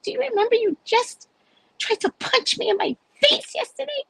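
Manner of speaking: very high-pitched indignant question; hammed-up outraged comeback; mock incredulity. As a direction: squeal it in pretend disbelief. Imagined incredulous reply to sweet greeting.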